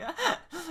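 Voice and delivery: sudden high burst of laughter. Real, not acted. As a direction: laugh out loud, high and bright. Laughs at own question.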